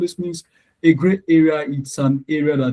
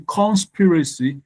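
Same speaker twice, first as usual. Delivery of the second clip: CONspiracy, CON pushed high and drawn out; stress on first syllable; 4 semitones higher, slower than usual. Shown as a demonstration. Teacher quizzing class on conspiracy, stressed on CON. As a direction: slowly hit the CON of conspiracy. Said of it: "are we supposed to pronounce loudly".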